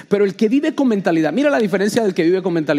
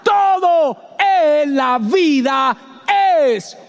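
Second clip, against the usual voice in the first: slow, high full-force shout; slow shouted sermon climax; preaching shout; 13 semitones higher, much slower than usual. Acted. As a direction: drive the point home at the top of your voice.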